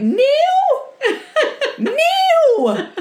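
very high, loud call up the stairs; mum calling out. Retold or imitated. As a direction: mimic mum shouting his name, breaking into laughter. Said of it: "you went".

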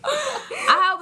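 high, slow laughing. Real, not acted. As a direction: crack up mid-sentence. Laughing at friend's small number.

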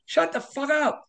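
high-pitched, slow mock scream; snapping at the doctor in the hospital, high slow mock scream; retold screaming. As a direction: yell it way up high, drawn out. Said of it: "I started screaming at the doctor".

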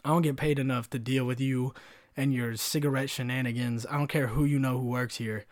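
normal talking voice

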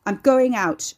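loud, high angry shout; yelled. A narrator's reading. Ricky yelling to his mother upstairs, angry. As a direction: yell it up to someone in another room. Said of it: "Ricky yelled".

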